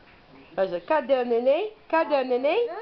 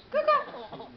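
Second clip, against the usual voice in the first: peekaboo squeal; 13 semitones higher than usual. Acted. Peekaboo game with baby.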